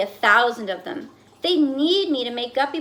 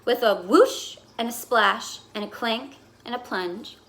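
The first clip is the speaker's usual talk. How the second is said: ordinary delivery, nothing marked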